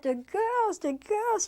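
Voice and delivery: high, sing-song playing dumb; feigned innocence. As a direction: repeat it high as if only now remembering. Artaud plays innocent to gendarmes.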